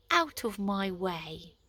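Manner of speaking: usual speaking voice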